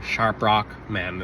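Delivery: ordinary talk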